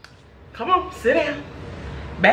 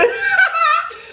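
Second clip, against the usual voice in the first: high squeal laugh. Real, not acted. Laughing at partner.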